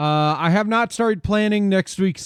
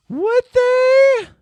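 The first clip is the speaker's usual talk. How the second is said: much higher, squeaked mock question; squeaky mock doubt; 5 dB louder than usual. Streamer doubting chat in a squeaky, much higher voice. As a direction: squeak it out in disbelief.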